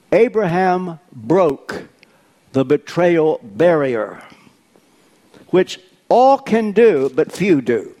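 slow, emphatic preaching. Acted. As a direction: lean into each word like a preacher.

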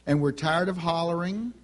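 ordinary talk

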